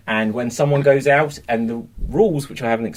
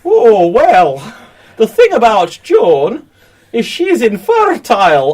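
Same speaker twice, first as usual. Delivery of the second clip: high nurse voice; 7 semitones higher, 7 dB louder, slower than usual. Acted. Nurse voice in scene.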